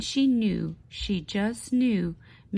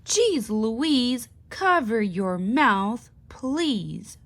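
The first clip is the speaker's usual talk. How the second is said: louder, annoyed scolding of a classmate; not-so-nice voice; 3 semitones higher, 5 dB louder, slower than usual. Acted. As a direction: snap it at her, clearly irritated. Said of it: "in a not-so-nice voice".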